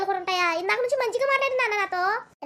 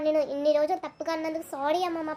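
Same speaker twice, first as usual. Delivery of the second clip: usual speaking voice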